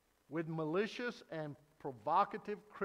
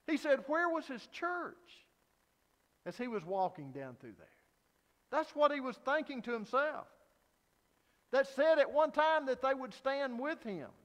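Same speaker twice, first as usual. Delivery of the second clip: impassioned preaching; 6 semitones higher, 4 dB louder than usual. A narrator's reading. Impassioned retelling of abandonment.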